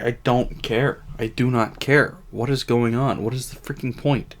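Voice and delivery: normal talking voice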